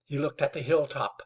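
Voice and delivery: ordinary delivery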